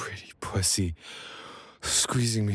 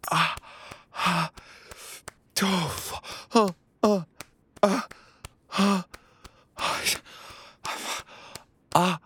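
high breathy moans; 14 semitones higher than usual. Acted. Climax in an intimate roleplay.

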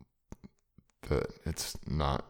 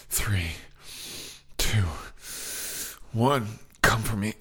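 building excitement; 6 semitones higher, 4 dB louder than usual. Acted. Countdown building to climax.

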